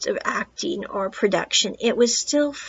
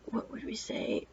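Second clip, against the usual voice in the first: a hushed whisper to herself, searching for words; whispered aside; 15 dB quieter than usual. Real, not acted. Reviewer pausing to pick words, whispering to herself. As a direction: whisper it under your breath, thinking aloud.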